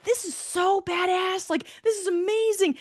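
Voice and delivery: very high-pitched, stretched-out excitement; thrilled. Retold or imitated. Hiker reliving the storm hike, exaggeratedly thrilled.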